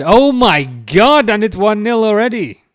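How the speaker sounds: high, loud cry of disbelief; aghast high-pitched shout at a goal; shocked outburst. Real, not acted. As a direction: yell it out, stretching the first words. Reacts to conceding a goal live.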